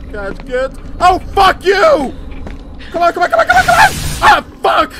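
high, loud panicked yelling; panicked screaming. Real, not acted. Chased by monster, screaming.

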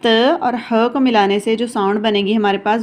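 ordinary delivery

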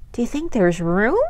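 usual speaking voice

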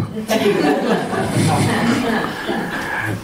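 high, hearty burst of laughter; real laugh. Real, not acted. Preacher laughs at the retold chant, high burst of laughter.

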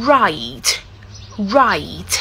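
"right" low, falling, rounded r; clear r and t. Shown as a demonstration. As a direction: model "right" with released final t. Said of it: "กต้องชัดเจนนำ". ("right" เสียงต่ำ r หน้า t ท้ายชัด)

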